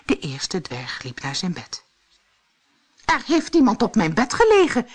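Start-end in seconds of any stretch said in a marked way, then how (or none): 3.0-4.9 high, loud, quick announcement; fast raised-voice cry; dwarf calling out. A narrator's reading. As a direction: exclaim it as an alarmed storybook dwarf. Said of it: "riep hij".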